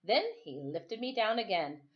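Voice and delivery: ordinary delivery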